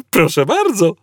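high, obliging machine voice; chirpy computer. A narrator's reading. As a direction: sing it out merrily as a helpful computer.

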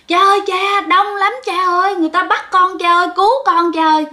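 high, panicked wailing, rushed in the middle; retold shriek for help. Girl on the ground screaming to her father, high panicked wail. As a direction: shriek to her father as if being dragged away. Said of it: "gào thét".

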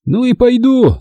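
high sulky agreement; offended. A narrator's reading. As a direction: huff it out like a hurt child. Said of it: "обиженно согласился Толик".

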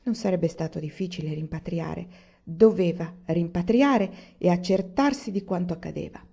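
plain reading voice

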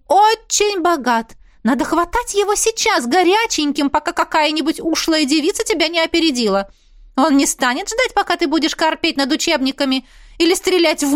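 high, excited chatty mother; mother gushing. Acted. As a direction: gush like a thrilled mother. Mother lecturing daughter. (восторженная мама, высокий голос)

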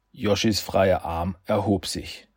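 usual speaking voice

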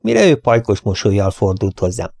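plain reading voice

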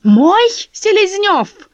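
high, loud squeal; shrieking. Acted. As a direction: shriek it out in outrage. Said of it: "взвизгнула Лиза". (визгливо, громко)

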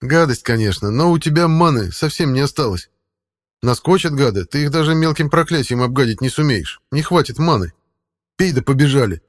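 high, loud cat voice. Acted. Cat speaks in story.

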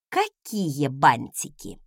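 low, slow, menacing question; threatening. A narrator's reading. Wife answering her husband's remark about bows, low and threatening. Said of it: "угрожающе процедила я".

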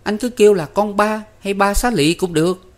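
put-on high, loud boyish character voice; boy Năm, lively. A narrator's reading. Năm tells the guest his sister's nickname, lively high voice. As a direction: pitch it high and forward as the eager younger brother.

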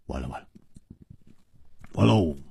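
low, hushed defeat; resigned sigh. A narrator's reading. Shaking his head at his friend's feat, deflated and quiet. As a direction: let it out softly like a long exhale. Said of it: "胡铁花终于长长的吐了一口气".

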